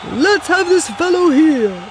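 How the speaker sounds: Santa. A narrator's reading. Santa voiced in story.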